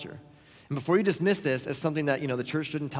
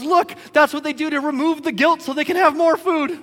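high, louder, animated explaining; eagerly pointing out a strange human custom; imitating raccoon guide; 10 semitones higher, 6 dB louder than usual. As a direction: pitch it up like an excited raccoon guide. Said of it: "and he goes".

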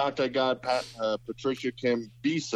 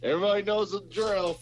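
raised bossy official voice; playing a stern border guard; mock announcement; 6 semitones higher than usual.

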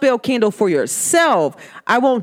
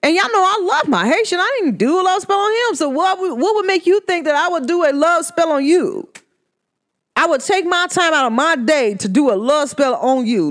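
indignant disbelief; 8 semitones higher than usual. Acted. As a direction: act offended he'd even think it. Indignant rant at the dude.

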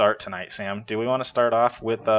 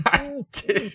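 laughing; 13 semitones higher, 4 dB quieter than usual. Real, not acted. Laughs after failed hum.

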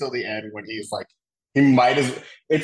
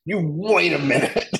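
mock actor line; 6 semitones higher than usual. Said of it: "he's like".

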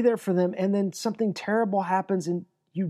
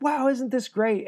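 higher, bubbly mock wonder; mock delight; 6 semitones higher than usual. Pastor mimicking churchgoers' cheering, mock delighted. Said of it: "most of us are like".

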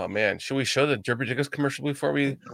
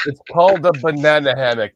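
laughing; 2 semitones higher, 9 dB louder than usual. Real, not acted. Correcting friend while laughing.

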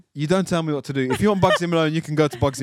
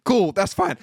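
ordinary talk

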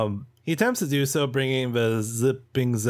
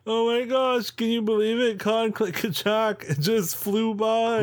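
high, gushing spectator; cheering fan; 11 semitones higher than usual. Acted. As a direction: starstruck onlooker, voice pitched way up. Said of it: "are clapping and cheering".

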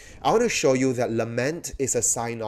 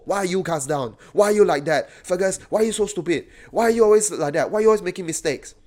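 quick, higher-pitched self-reproach; scolding himself; 5 semitones higher, 4 dB louder, faster than usual. Acted. Preacher talking to his own soul, mock scolding. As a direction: rattle off exasperated questions at yourself. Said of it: "but scold myself".

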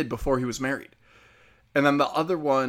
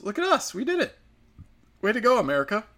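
much higher mock-proud cheer; sarcastic cheer; 8 semitones higher than usual. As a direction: cheer the grim milestone in jest, high up. Sarcastic cheer over covid deaths.

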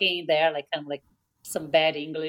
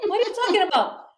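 high, fast puzzled protest; friend's mock bafflement; high, fast baffled reply; 11 semitones higher, faster than usual. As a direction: blurt it out baffled. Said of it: "She's like".

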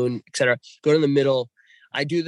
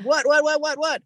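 wife alarmed; 13 semitones higher, 3 dB louder than usual. Retold or imitated. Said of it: "She's like".

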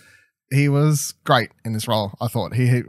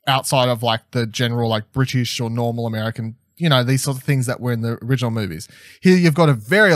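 normal talking voice

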